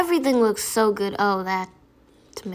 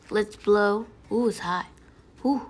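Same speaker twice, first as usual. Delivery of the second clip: usual speaking voice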